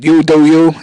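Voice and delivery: put-on loud, punchy East Coast accent. Host shows Jethro the saying, East Coast accent. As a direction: say the motto with East Coast swagger. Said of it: "You do that thing so good".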